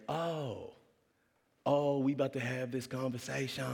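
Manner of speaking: hammed-up dad realizing a talk is due; exaggerated dad voice. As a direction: stretch it out in a caricature voice. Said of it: "My dad doesn't talk like that".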